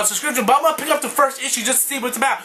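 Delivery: ordinary talk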